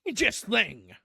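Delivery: an uncle spluttering with indignation; violent outrage. A narrator's reading. Uncle repeating his verdict to family, violent outrage. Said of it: "said Uncle Benjamin violently".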